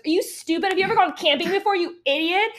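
high, loud, fast sneering insult; mocking angry commenter. Commenter scolding her about camping, loud and sneering. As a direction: scoff at her like an outraged commenter. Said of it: "twenty comments being like".

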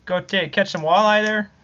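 folksy put-on Upper Midwest accent; playful Wisconsin lilt; mock Wisconsin accent. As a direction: tease in a jokey Wisconsin accent. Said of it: "You'll be talking like me".

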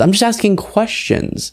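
high, slow, faux-innocent; sarcastic impression of a TV host feigning innocence; mocking Tucker Carlson. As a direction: play dumb, just asking. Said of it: "he asks questions".

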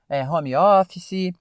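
"home office" slow, as "homi ófici" with added final vowels; drawn-out Brazilian "homi ófici"; Brazilian pronunciation. Shown as a demonstration. Teacher to learners, slow Brazilian-style "homi ófici". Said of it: "é a maneira que nós brasileiros falamos".

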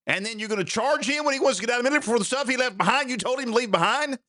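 high and fast, tumbling out in outrage; indignant rant. Real, not acted. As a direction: pile the accusations on quickly.

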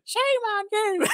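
very high mock old-lady scold; mocking the librarian. Boy behind the librarian mimicking her to her back, very high mock scold. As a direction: echo her back in a squeaky falsetto. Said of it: "then I said".

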